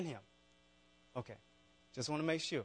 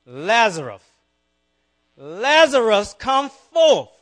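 calling out loud and high, rising each time; loud commanding call across a distance; loud cry; 6 semitones higher, 15 dB louder, much slower than usual. A narrator's reading. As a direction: summon the dead man from the tomb. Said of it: "he cried out in a loud voice".

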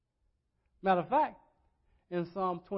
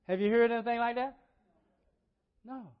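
ordinary talk, nothing marked

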